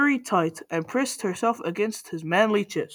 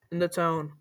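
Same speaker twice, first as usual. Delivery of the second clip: ordinary delivery, nothing marked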